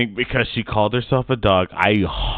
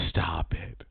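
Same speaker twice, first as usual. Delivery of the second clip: whisper; 3 semitones lower, 7 dB quieter than usual. Real, not acted. Whispering plea to co-host.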